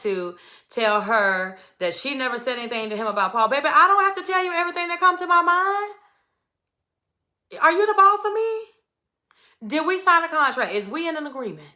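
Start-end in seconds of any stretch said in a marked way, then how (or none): 3.5-11.7 much higher, fast, indignant diva voice; sassy comeback. Retold or imitated. Imitating Jasmine snapping back at Zach, high and sassy. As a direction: toss it back at him, high and outraged. Sassy retort to Zach's accusation.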